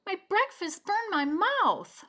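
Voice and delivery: high, loud complaining cub voice; high little bear whining about hot soup; whiny little bear. A narrator's reading. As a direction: whine it like a hurt little cub. Baby Bear burned his mouth.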